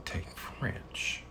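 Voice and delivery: soft whispered aside. Real, not acted. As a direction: breathe the words out voiceless.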